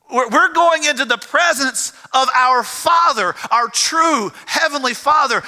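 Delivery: high, ringing proclamation; impassioned preaching. Real, not acted. Preacher proclaiming the heavenly Father's rescue, impassioned preaching.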